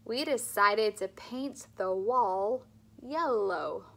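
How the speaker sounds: "love life" with slow, clear L; slow, careful speech-therapy model; slow clear L drill. Shown as a demonstration. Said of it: "Two more".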